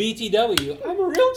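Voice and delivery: high, loud sing-song brag; cheeky announcement. Retold or imitated. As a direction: crow it like a punchline. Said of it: "So you said".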